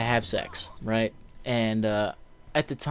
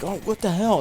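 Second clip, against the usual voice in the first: alarmed; 9 semitones higher than usual. Retold or imitated. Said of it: "I'm like".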